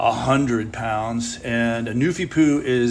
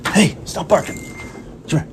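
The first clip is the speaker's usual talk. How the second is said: scolding a dog; 5 semitones higher than usual. Real, not acted.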